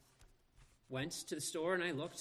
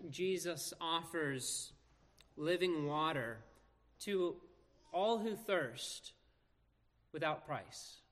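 slow, phrase by phrase, pausing before "all who thirst"; deliberate emphasis; slower than usual. Real, not acted. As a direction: draw out "all who thirst" as the key point. Preacher states theme, then repeats it.

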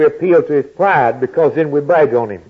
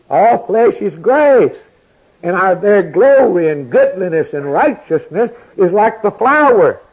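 raised pulpit proclamation, leaning on key words; forceful preaching; 6 semitones higher, 3 dB louder than usual. Acted. Preacher telling the congregation the withered flowers are us, forceful preaching. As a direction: press the verse home on the listeners.

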